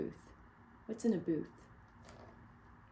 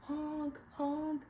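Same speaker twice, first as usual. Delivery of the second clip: high imitated honk honk; mock car horn blasts; 8 semitones higher than usual. A narrator's reading. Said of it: "went the horns on the speeding cars".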